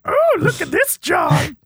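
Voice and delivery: hammed-up Piggy brag; Miss Piggy voice. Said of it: "Miss Piggy's on his arm like".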